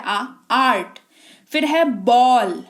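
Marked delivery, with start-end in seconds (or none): 0.0-1.0 आ drawn out, then आर्ट; vowel sound demo. Shown as a demonstration. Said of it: "आ सुनाई दे रहा है". (आ को खींचकर फिर आर्ट)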